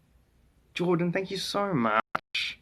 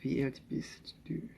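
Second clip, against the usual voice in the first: whispered German; 3 semitones lower, 13 dB quieter, slower than usual. Shown as a demonstration. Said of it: "How old are you?".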